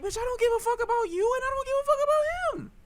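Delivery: high, fast mock-indignant outburst; exasperated retort. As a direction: shoot up into a high-pitched, fast squeal. Acted retort in relationship story.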